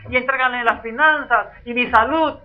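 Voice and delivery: lightly hammed-up exorcist prayer; mock shouting preacher. Shown as a demonstration. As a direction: keep mocking the showy prayer. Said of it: "gritar y vociferar".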